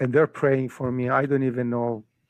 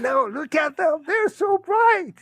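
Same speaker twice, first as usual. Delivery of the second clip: excited insistence. Retold or imitated. Man urging his doubting friend to look, excited. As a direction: insist in a high, amazed voice. Retold insisting the daffodils glow.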